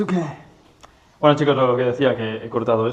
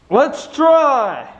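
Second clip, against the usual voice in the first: eager; 14 semitones higher, 8 dB louder than usual. Real, not acted. Straining to fit the part.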